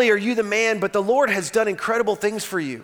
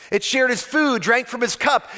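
ordinary talk

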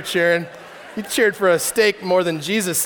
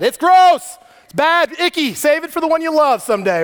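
higher, louder prim warning; mock prudish; 5 semitones higher, 4 dB louder than usual. Pastor mocking the church's prudish line on sex, higher and louder. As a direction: sound like a scandalized church parent.

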